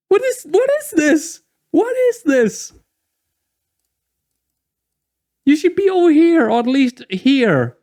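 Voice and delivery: real frustration boiling over at the defense; exasperated disbelief. Real, not acted. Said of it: "I'm getting really frustrated now".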